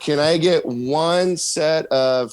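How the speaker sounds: put-on slowed down for a child; slow, careful. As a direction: spell it out slowly.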